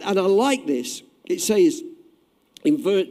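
ordinary delivery

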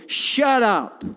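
loud, high stern rebuke; stern shout. Acted. Jesus telling the devil to shut up, loud stern shout. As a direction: order the storm to hush. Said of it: "He was saying".

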